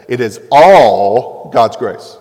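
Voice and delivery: ALL stretched long and loud; stress on ALL. Real, not acted. Preacher telling believers salvation is God's, heavy drawn-out ALL. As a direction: lean hard on ALL, slowly.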